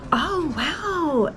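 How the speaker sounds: high, impressed tourist wow; mock wow. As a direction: gush like a tourist.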